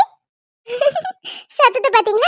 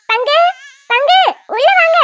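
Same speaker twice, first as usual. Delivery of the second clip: raised, higher-pitched summons; calling out; 4 semitones higher, 3 dB louder than usual. Acted. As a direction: call someone in from outside. Calling father in from outside.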